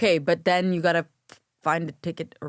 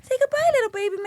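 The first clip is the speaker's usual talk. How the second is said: put-on very high cooing baby talk; much faster than usual.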